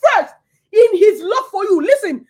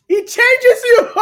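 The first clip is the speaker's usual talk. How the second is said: higher, louder, slow, laughing through the words; joyful laughing cry; 7 semitones higher, 8 dB louder, slower than usual. Real, not acted. Preacher to congregation, slow laughing joy. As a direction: let the joy break out as laughter.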